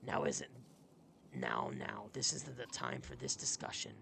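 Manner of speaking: deep-voiced old warrior cutting in; deep character voice. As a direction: drop the voice low to play the warrior. Voicing a gruff game character.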